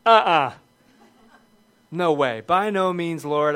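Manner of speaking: flatly refuse, loud and firm; emphatic refusal. Retold or imitated. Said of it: "just an emphatic".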